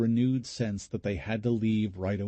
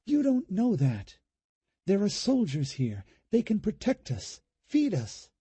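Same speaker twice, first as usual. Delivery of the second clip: high, pushing back hard; heated objection; 11 semitones higher than usual. Acted. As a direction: argue back, refusing to believe. Julie rejects Ed's fear.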